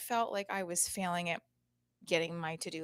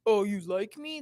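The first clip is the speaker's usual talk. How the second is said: louder taunting question, acted out; mock teasing; 8 dB louder than usual. Mom asks daughter if the boy teased her, mock teasing. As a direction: tease like a schoolkid. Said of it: "Did he tease you?".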